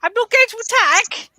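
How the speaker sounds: high-pitched, anxious backing-off voice; nervous reassurance. Acted. Character stepping back from a flailing spinner, nervously reassuring. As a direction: placate someone nervously, pitched well up.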